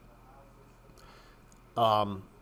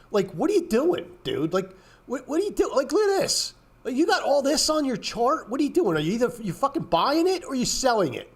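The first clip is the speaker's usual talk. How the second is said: exasperated rant; 10 semitones higher than usual. Real, not acted. Ranting at chart painters.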